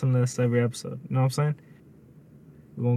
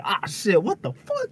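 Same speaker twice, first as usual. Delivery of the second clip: mock startled outburst; 5 semitones higher, slower than usual. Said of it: "that's when I say".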